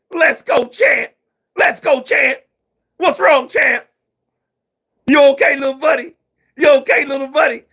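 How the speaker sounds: high, loud hype-man calls; Shannon Briggs impression. Imitating Shannon hyping himself up, high and loud. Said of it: "We got Shannon the Cannon".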